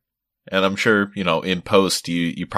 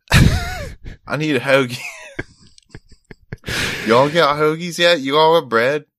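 hammed-up Philly accent, higher and louder, hungry for a hoagie; a Philly guy begging for a Wawa hoagie in a jokey Philly accent; mock Philly accent; 8 semitones higher, 3 dB louder than usual. Vampire hunter drunk on meat craving a hoagie, mock Philly accent. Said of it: "my shitty mid-Atlantic accent".

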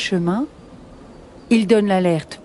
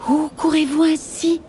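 high, sinuous serpent voice; hissing snake; 7 semitones higher than usual. Acted. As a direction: ask it as a high-pitched snake. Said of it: "siffle-t-il".

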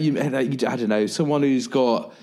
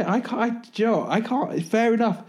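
amused disbelief; 11 semitones higher than usual. Real, not acted. Reacts to skater wear trend.

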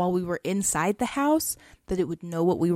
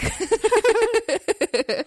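high, loud burst of laughter; long, high-pitched laughing fit; real laugh; 13 semitones higher, 5 dB louder than usual. Real, not acted. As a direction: laugh out loud at your own joke. Laughing at own antibiotic comparison.

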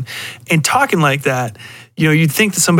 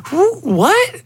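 much higher, incredulous squeak; stunned surprise. Retold or imitated. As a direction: act floored by the sight of him. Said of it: "I'm just like".

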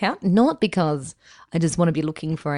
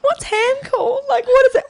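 laughing; 5 dB louder than usual. Real, not acted. Laughing, searching for word.

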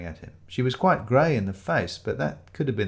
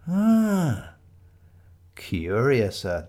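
a lightly voiced delighted sleuth, slow; Shiloh relishing the mystery; savouring; 3 semitones higher, much slower than usual. A narrator's reading. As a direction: draw out the word with relish.

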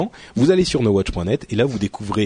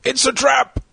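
movie quote voice; 12 semitones higher, slower than usual. Pretend. Quoting famous movie line.

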